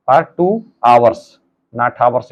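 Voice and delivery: "hours" said as "aurs", the h silent, slowly; teacher models "hours" with no h sound, drawn out. Shown as a demonstration. Teacher shows students "hours" with a silent h, slow and careful. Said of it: "इसको हावर्स नहीं पढ़ेंगे".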